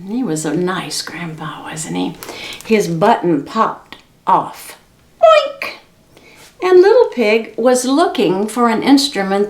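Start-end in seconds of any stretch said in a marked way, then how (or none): 0.1-2.1 whisper. Real, not acted. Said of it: "whispered aside to children". 5.2-5.8 high boink. Acted. Button popping sound.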